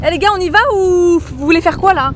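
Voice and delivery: loud, high call to wake friends. Real, not acted. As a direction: shout across the boat to the group. Waking up sleeping friends on boat.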